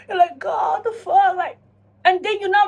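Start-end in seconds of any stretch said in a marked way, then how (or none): 0.4-1.4 high, hammed-up tearful outburst; mock crying. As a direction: cry it out at the mirror, playfully. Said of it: "you're crying and you're like".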